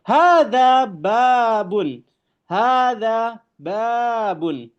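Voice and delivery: slow, high, careful Arabic recitation of "Hadza babun"; Arabic model reading. Shown as a demonstration. Teacher reading Arabic to students, slow and emphatic. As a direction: drawl out "Hadza babun" for the class to copy. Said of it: "Nah, ini adalah sebuah pintu".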